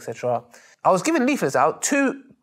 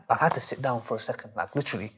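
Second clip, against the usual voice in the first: ordinary delivery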